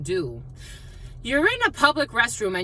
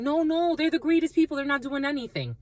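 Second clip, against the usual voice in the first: naive denial; 7 semitones higher than usual. Retold or imitated. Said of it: "I'm like".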